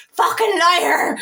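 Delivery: high, loud drawn-out yell; retold angry scream. Woman screaming at the affirmation on her wall, angry yell. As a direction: re-enact screaming in anger at the wall. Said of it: "I was screaming, going".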